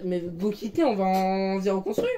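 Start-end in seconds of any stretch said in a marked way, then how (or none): none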